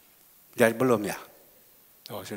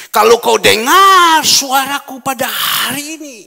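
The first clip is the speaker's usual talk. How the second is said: loud, high preaching shout; preaching at full force; 8 semitones higher, 14 dB louder than usual. Real, not acted. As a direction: proclaim it to the whole church. Preaching climax. (khotbah berapi-api dengan suara keras)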